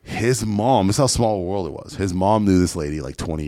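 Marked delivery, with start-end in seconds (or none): none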